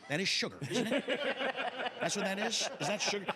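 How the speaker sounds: be baffled by pixie sticks; confused old man impression. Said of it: "social media post that was based on that".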